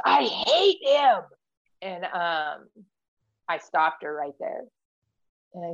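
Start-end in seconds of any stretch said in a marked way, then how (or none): 0.0-1.2 high, slow, drawn-out outburst; a child's hot anger, retold in a high voice; imitated angry child. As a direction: mimic a child's furious declaration. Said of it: "she got so mad. She started shaking".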